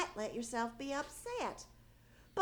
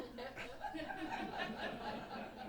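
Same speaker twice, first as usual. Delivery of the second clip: soft laugh; 9 dB quieter than usual. Real, not acted. Wife laughs at the baseball talk.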